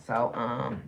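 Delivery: normal talking voice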